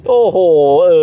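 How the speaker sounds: high, loud wow; amazed wow. Real, not acted. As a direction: gasp it out in awe. Amazed at time. (อุทานอย่างทึ่ง)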